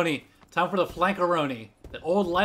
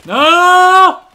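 anguished scream; 13 semitones higher, 16 dB louder than usual. Real, not acted.

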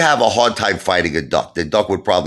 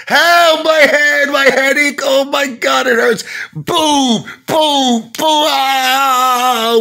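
high falsetto shrieks for help, much louder; mock screaming Lou; 6 dB louder, much slower than usual. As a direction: play a whimpering coward being hit. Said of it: "Lou be screaming".

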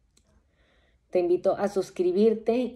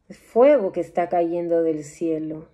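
normal talking voice

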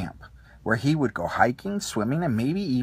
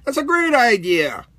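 higher and louder heroic cry; excited cry; 9 semitones higher, 9 dB louder than usual. A narrator's reading. Space ranger cheering the camp idea, excited cry. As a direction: proclaim it like a bold space ranger. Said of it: "Buzz Lightyear the space ranger cried".

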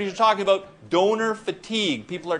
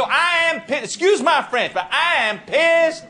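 high-pitched imitated anger on the radio; mock-mad mayor; 8 semitones higher than usual. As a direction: play the mayor fuming on air. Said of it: "'Cause he was mad".

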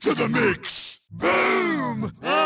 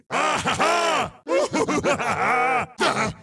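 long, hammed-up cackle; gloating laugh. Racer finding it most pleasing, long gloating cackle. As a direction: cackle on and on with relish.

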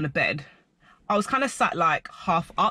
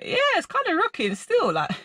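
confused high voice. Retold or imitated. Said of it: "I was like".

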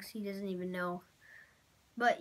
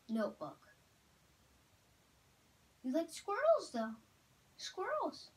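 talking to a dog; 6 semitones higher, 4 dB quieter than usual. Real, not acted. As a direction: tease the dog with excited questions. Exciting dog with words.

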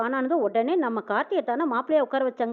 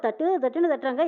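usual speaking voice

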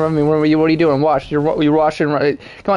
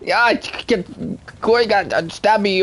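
lightly put-on British accent, a touch higher; a hint of a mock British accent; 6 semitones higher than usual. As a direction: try a bad British accent. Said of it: "that was my British accent".

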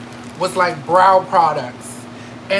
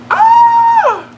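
excited scream; 8 dB louder than usual. Real, not acted. Opening blush trio.

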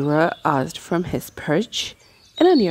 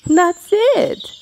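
high, louder joyful cheer; 11 semitones higher, 5 dB louder than usual. Acted. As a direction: cheer him on with delight. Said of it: "Mowgli cheered".

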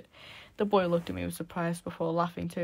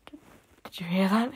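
half scream; 6 dB louder than usual. Acted. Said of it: "I half screamed".

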